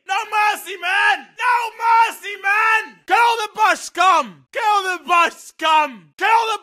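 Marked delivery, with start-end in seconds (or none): none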